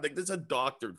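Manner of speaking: ordinary talk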